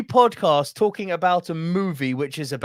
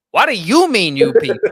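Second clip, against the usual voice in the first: the quote again, louder and affronted; louder indignant repeat; 4 semitones higher, 10 dB louder than usual. Retold or imitated. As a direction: punch the repeat with mock outrage. Quoting movie line, louder take.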